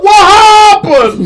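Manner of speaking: hammed-up wailing cry, very high and drawn out; Lucy. Friend mocking attempts to copy Lucy's cry, exaggerated wail. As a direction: bawl it out in a loud mock sob. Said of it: "you got to nail the cry".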